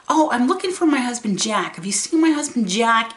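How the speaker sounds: lightly voiced elderly mother; imitating confused mom. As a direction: ask about her husband, lost.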